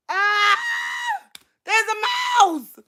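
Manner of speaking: high, slow, scared cry; frightened. Real, not acted. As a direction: wail about the mouse. Frightened by mouse.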